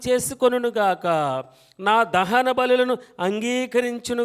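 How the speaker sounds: full-voiced scripture proclamation; preaching at full force. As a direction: proclaim each line so the church follows. Leads congregation reading psalm.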